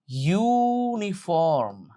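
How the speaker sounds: ইউনিফর্ম with a long, drawn-out "yuu" at the front; pronunciation demo. Shown as a demonstration. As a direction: stretch ইউনিফর্ম out slowly, holding the opening "you" sound. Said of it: "আগে উচ্চারণ খেয়াল করবা". (ইউনিফর্ম-এর শুরুর "ইউ" লম্বা টেনে উচ্চারণ)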